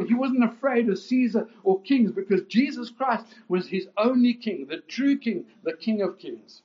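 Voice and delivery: high, loud, driving pulpit proclamation; preaching at full force. Acted. Preacher to his congregation, at full force.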